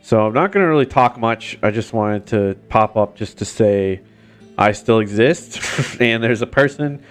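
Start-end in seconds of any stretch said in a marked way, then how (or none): none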